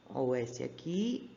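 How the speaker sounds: ordinary talk, nothing marked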